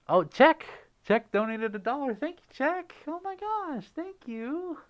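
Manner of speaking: very high-pitched, gushing thanks; real giddy surprise at a gift; excited gratitude. Real, not acted. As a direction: burst out delighted, then squeak the thanks. Reacting to a donation.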